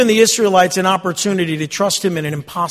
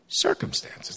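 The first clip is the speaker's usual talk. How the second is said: high, soft whispered Circumstances; 13 semitones higher, 11 dB quieter, slower than usual. Shown as a demonstration. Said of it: "And what happened?".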